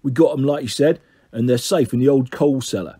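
low Cockney thug reporting in; deep Cockney growl. Acted. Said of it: "a deep growl with a strong Cockney accent".

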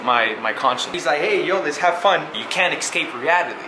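ordinary talk

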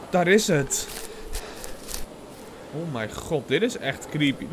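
ordinary delivery

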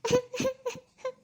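put-on a grieving young woman close to sobbing; tearful daughter. Daughter grieving her lost baby to her mom, tearful.

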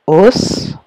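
"os" alone, loud, with a long s; isolated syllable. Shown as a demonstration. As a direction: sound out "os" as a separate syllable. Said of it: "première syllabe".

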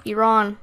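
"Iran" said as ee-RON; "Iran" with an open AH second vowel; ee-RON pronunciation. Shown as a demonstration. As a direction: say "Iran" starting with "ee", ending in "ron". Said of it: "how you actually pronounce that".

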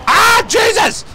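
sudden high yelp of alarm; startled shout. Real, not acted. Caught off guard by an enemy vehicle, startled high yelp. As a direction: jump out of your skin mid-sentence.

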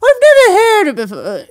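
high, loud hammed-up royal voice; Queen Elizabeth. Shown as a demonstration. Guest shows off impression, mock regal British voice. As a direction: do an exaggerated British monarch. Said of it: "my Queen Elizabeth impression".

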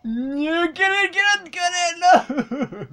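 slow, high drawn out urging; a long high plea at the screen. Real, not acted. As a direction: stretch each get it out high. Urging the game on.

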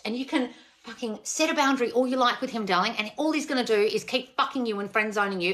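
normal talking voice

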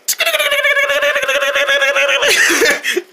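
long, very high stuttering cackle; high-pitched cackle. Acted. Character cackling alone after asking who's there, high cackle. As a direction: rattle out a shrill cartoon laugh. Wordless cackle in puppet play.

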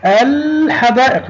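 الحدائق high, with the l held long; pronounced lam. Shown as a demonstration. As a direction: say الحدائق as alll-hadaa'iq. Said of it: "نعم، نطقنا اللام".

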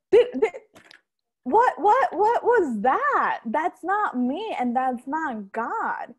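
high, stammered double take, slowing as it lands; startled disbelief. Retold or imitated. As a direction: act out a sudden shocked realization. Startled realization, retold.

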